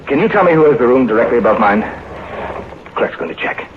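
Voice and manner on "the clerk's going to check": slow whispered aside to wife. Acted. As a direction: cover the phone and whisper. Aside while on the phone.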